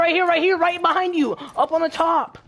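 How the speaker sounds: high, loud urgent shout; urgent callout. Real, not acted. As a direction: yell it to the team. Calling out enemy position urgently.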